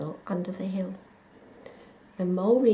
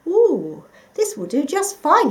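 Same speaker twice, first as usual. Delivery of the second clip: pleased; 8 semitones higher, 6 dB louder than usual. Acted. Fox pleased with hole.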